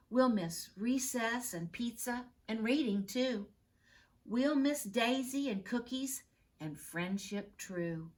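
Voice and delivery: lilting sing-song recital, building to a cheer; poem read aloud. Acted. Class poster read to the teacher, recited with growing cheer.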